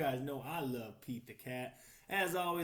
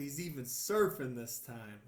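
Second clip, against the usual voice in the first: ordinary delivery, nothing marked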